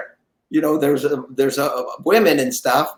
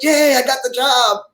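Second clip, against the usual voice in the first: higher, imitated cheer of joy; mock cheer; 9 semitones higher than usual. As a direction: cheer it like a kid. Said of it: "And I went".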